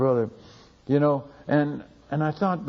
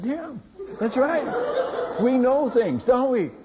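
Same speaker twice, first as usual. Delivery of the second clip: high, laughing yes to the villagers; laughing as he confirms they know things; gleeful agreement; 12 semitones higher, slower than usual. Retold or imitated. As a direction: share the secret with delight.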